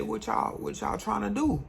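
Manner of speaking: high mock street challenge; quoting the gunman. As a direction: pitch it up like the guy sizing you up. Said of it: "He said".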